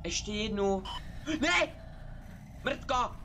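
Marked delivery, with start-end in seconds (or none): 1.4-3.1 terrified shout. Real, not acted. Streamer caught by the monster, terrified shout. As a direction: scream as the monster appears.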